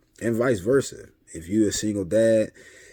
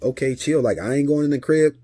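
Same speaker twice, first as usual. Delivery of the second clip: raised, louder retold retort; mildly exasperated; 7 semitones higher, 6 dB louder than usual. Dad telling worried ex he won't come inside, mildly exasperated. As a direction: brush off her worry with a touch of exasperation. Acted argument with his ex.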